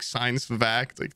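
normal talking voice

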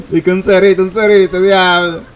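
laughing while talking. Real, not acted. Laughing, loud game talk.

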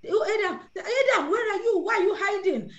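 high-pitched acted calling out; storyteller voicing God, pitched well up; God calling Adam. Acting God calling Adam in Eden.

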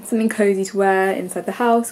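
ordinary talk, nothing marked

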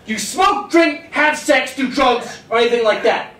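insistent doctor. Retold or imitated. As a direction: push hard for the truth. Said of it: "And he said".

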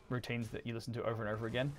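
ordinary talk, nothing marked

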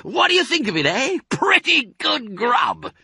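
very high, gleeful boast; excited cry. A narrator's reading. Fox asks friends to admire the food, excited. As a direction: crow over the feast. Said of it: "cried Mister Fox, dancing up and down".

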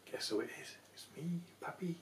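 put-on hushed secret whisper to the kids; teasing whisper; playful whisper. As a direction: whisper it like a surprise. Playful hello to grandchildren.

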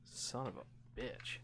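slow unvoiced curse; whispered curse. Real, not acted. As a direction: breathe it out defeated.

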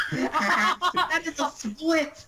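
high, slow laugh-through words. Real, not acted. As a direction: laugh as you say it. Laughing at character pose.

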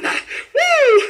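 ringing high "woo"; high whoop. Real, not acted. Preacher rejoicing that sobbing became singing, high whoop.

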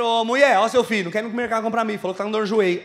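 loud, fast country call to the wife. Retold or imitated. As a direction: yell it across the house, rushed. Rural husband calling to wife. (marido do interior chamando a mulher, alto e rápido)

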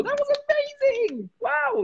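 high, gushing praise; puppy praise. Pretend. Praising the puppy for pooping outside, high and excited. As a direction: squeal it to a puppy.

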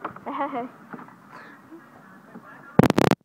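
short chuckle trailing off; real, spontaneous little laugh; amused laugh. Real, not acted. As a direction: chuckle at your own mess. Amused after describing tangled pole.

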